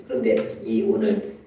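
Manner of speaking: ordinary delivery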